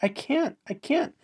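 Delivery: high-pitched mock refusal; protesting. Man refusing the offer again, high mock protest. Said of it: "And he's like".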